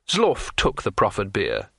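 usual speaking voice

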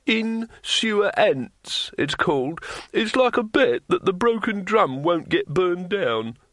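high, slow, fumbling explanation; a hint of a cornered innkeeper; nervous innkeeper. A narrator's reading. Innkeeper explaining the bet to the thief master, nervously. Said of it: "said the innkeeper nervously".

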